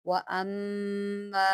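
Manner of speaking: व अम्मा with the ma chanted long. Shown as a demonstration. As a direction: draw out the ma in व अम्मा, sung. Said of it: "आपने माँ को लंबा खींचना है".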